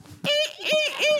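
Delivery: a high laugh after mocking the dream. Real, not acted. Laughs at naive hope.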